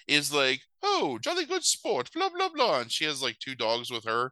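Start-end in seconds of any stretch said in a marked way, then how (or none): 0.8-2.8 high, posh British queen impression; a high hammed-up British accent; mock British queen. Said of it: "woman that maybe is supposed to be the queen".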